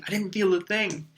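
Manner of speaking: high, eager; excitedly. A narrator's reading. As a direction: blurt it out thrilled. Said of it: "Harry said excitedly".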